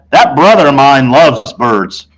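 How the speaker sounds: usual speaking voice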